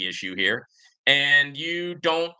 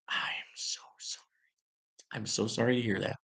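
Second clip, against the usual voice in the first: sarcastic hushed sorry; mock whispered apology; 4 semitones lower, 8 dB quieter than usual. Host mocking the critic with a fake hushed apology.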